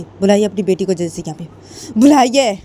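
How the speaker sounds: rising to a loud furious shout; angry mother shouting at the other family; angry demand. Acted. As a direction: demand the daughter be brought, angrily. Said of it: "गुस्से में".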